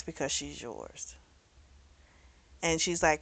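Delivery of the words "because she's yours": admitting. Retold or imitated. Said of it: "And she admits".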